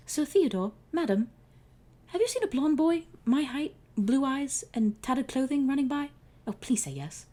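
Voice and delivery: high, urgent questions; anxious pleading. Acted. Akmun Ra asks Roosevelt and Sacagawea, high anxious plea. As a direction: rush the description out worried.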